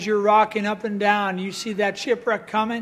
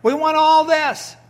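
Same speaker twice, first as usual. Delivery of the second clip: put-on higher, louder childish demands; mock crowd demanding louder; whiny demanding crowd; 5 semitones higher, 5 dB louder than usual. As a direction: whine like spoiled Israelites. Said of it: "they're complaining about that".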